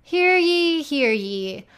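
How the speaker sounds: loud, ringing hear ye call; town crier. Acted. Mock ad, town crier opening.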